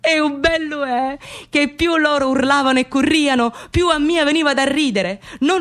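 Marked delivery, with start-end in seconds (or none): none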